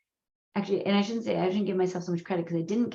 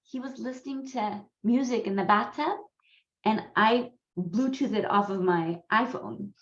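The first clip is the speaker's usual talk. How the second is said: ordinary talk, nothing marked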